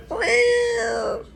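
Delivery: squeaky high cat meow. Pretend. Meows at end of song.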